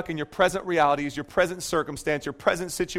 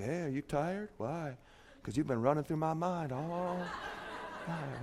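cheesy pickup line; 2 semitones lower, 13 dB quieter than usual. Retold or imitated. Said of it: "throw out your best pickup lines".

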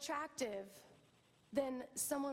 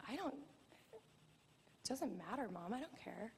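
dismissive daughter; 4 semitones lower, 6 dB quieter, slower than usual. Retold or imitated. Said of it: "she's like".

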